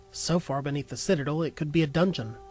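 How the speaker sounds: ordinary talk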